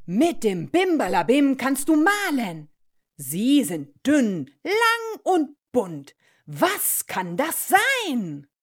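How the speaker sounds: emphatic, slowed-down quiz voice; riddling magic schoolbag. Acted. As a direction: stretch out each clue for children. Schoolbag poses another riddle. (Rätsel, langsam und betont gestellt)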